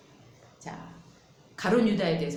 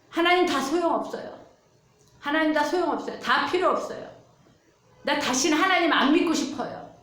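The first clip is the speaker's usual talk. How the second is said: raised, anguished cry to God; mock tearful outcry; 7 semitones higher, 4 dB louder than usual. Grieving man on the phone, cries out to God in despair. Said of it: "그러면서 막, 막 우시면서".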